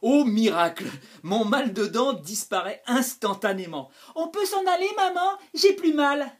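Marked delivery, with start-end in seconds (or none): 4.2-6.3 put-on small high child's voice; scared child. A narrator's reading. Little boy begging his mother to go, high and scared. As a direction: plead like a frightened little boy.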